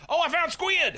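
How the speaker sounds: high, loud burst of delight; excited shout. Real, not acted. Gamer thrilled to finally find squid, shouting. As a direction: yell it out, thrilled at the catch.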